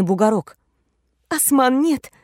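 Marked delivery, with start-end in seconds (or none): 1.3-2.1 breathless protest. Acted. Heroine protests during sex.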